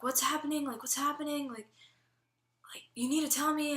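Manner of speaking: high, urgent pleading questions; imitated worried mom. Mom to crying daughter on the phone, urgent and alarmed.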